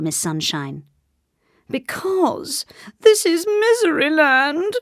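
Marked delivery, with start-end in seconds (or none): 1.7-4.7 high, slow, drawn-out wail; theatrical high lament; weeping king. A narrator's reading. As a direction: weep the explanation miserably. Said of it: "wept the king".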